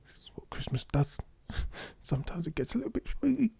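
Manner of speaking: spooky Dark Grinch whisper; menacing. Acted. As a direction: hush it like a sinister Grinch. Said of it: "You sound like the Dark Grinch".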